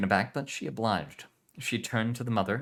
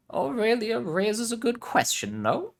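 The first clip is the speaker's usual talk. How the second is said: put-on Spanish accent; 6 dB louder, slower than usual. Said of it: "Spanish heritage".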